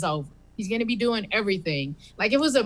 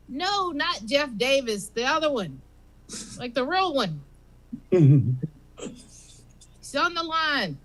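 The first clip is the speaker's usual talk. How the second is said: Bob the clerk; 3 semitones higher than usual. Acted. Bob telling Sue.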